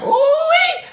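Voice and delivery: very high, excited falsetto whoop; ooh-wee squeal. Retold or imitated. Host showing how fast heart-tapping feels, a high excited squeal. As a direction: squeal it like you're thrilled. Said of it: "I'll be like".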